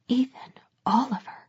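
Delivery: breathy stunned whisper. Acted. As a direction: whisper the name in shock. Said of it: "I whispered".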